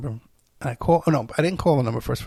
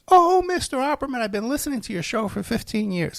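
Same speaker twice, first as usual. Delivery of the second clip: mock starstruck caller; gushing woman; 10 semitones higher than usual. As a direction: imitate an overeager fan, pitched up. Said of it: "and she goes".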